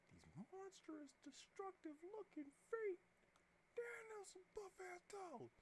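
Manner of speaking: slow, squeaky cartoon voice; silly high voice. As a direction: gawk at it in a goofy high voice. Mock-awed reaction to drawn feet.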